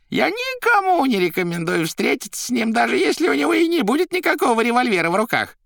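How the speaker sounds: very high, loud character voice; Koroviev squeaking. A narrator's reading. Koroviev warning Margarita off Azazello, high squeaky voice. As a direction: squeak on emphatically. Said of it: "пищал Коровьев".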